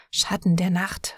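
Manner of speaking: ordinary delivery, nothing marked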